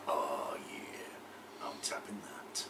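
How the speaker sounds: low breathy leering whisper. Retold or imitated. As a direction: whisper it smugly to yourself. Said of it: "be like".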